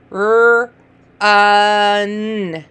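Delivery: R, uh, n each held long on its own; sounded-out letters. Shown as a demonstration. Teacher modelling sounds for a reader, each held separately. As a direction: draw out R, then uh, then n. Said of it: "making their sounds".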